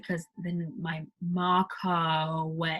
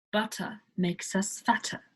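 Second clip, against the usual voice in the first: "Butter" with a crisp T and no R; British butter. Shown as a demonstration. Teacher demos the British T to learners. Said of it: "Instead of".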